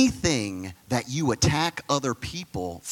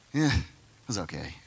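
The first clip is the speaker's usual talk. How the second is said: lower, bored, complaining Jesus; grumpy pretend Jesus; 5 semitones lower, 4 dB quieter than usual. Imagined Jesus griping to apostles, lightly voiced bored gripe. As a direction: grumble like a tired cynic. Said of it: "Can you imagine Jesus talking like this".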